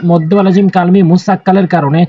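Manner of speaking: ordinary talk, nothing marked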